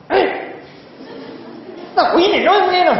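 sharp high rapid scolding yell; cha mẹ quát con. Retold or imitated. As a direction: bark it at the kid like an irritated parent. (quát con the thé, nhanh)

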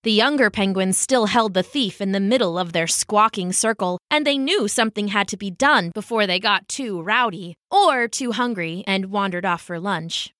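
plain reading voice

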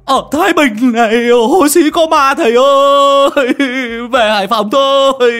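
high, loud and slow blubbering wail; wailing sob. Acted. Lâm Sẹo begging his master to go home, wailing sob. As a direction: wail it out, near tears. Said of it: "mồm mếu máo".

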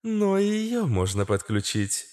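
high female character voice; Astra's system voice. A narrator's reading. Astra offers to connect the old battery, high voice.

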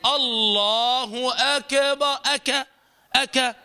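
drawn-out "أكبر" as "akeba"; bounced K. Retold or imitated. Teacher mimicking some imams' error, bounced K. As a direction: bounce the ك into "أكا". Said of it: "نرى بعض الأئمة".

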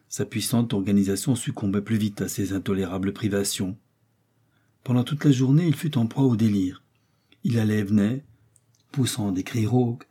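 ordinary delivery

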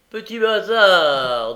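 lancer « bâtard » fort et lentement, plus aigu; insulte méprisante. A narrator's reading. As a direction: cracher l'injure à l'orphelin. Noé insulte Olivier. (gamin méchant qui insulte, fort et lent)

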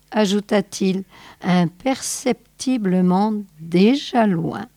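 ordinary delivery

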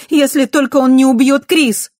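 high loud desperate cry; panicked shout. A narrator's reading. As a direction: yell it out in fear. (испуганный крик)

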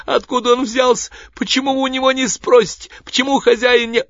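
high, loud, desperate screeching; panicked shrieking. A narrator's reading. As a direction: beg frantically, blaming another. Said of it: "голос кричащего". (панический визгливый крик)

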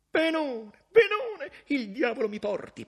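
very high, loud laughing delight; gleeful laugh. Acted. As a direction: chuckle with relief. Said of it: "pensò Cavaliov".

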